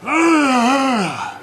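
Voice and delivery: loud, high, strained groan of disgust. Real, not acted. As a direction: let out a loud grossed-out ugh. Disgusted at a bug in his hair.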